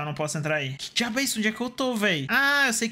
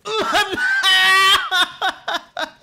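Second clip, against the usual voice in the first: high screaming burst of laughter; loud shrieking laugh; 13 semitones higher, 8 dB louder than usual. Real, not acted. Gamer shrieks with laughter after dying. Sudden death in shooter game.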